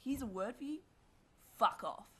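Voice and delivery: imitating a boy's loud dismissive shout; harsh brush-off. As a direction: retell his rude yell of fuck off. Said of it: "that's just the way he said it".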